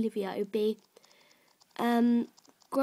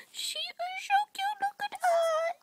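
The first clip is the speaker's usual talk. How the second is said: very high squeal of adoration; high-pitched gushing puppy voice. Real, not acted. Gushing over new puppy.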